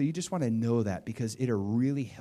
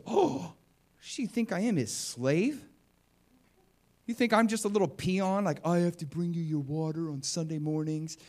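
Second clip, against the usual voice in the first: mock offended; 5 semitones higher than usual. Church lady taking the pastor's request the wrong way, mock offended. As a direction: act out an affronted woman, pitched up. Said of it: "she got offended and started criticizing him".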